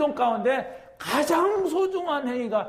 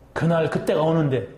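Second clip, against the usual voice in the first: usual speaking voice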